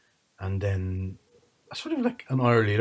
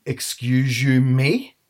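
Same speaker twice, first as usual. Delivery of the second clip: loud, higher indignant comeback; acted affront; 4 semitones higher, 8 dB louder, much slower than usual. To family who cooked without them, acted affront. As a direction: throw it back, hammed-up and offended.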